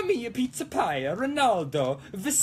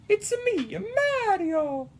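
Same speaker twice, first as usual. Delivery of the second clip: high-pitched cartoon Italian plumber; Mario; 11 semitones higher, slower than usual. Greeting viewers as a game plumber, high Mario voice. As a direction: sing out the catchphrase high, Italian accent.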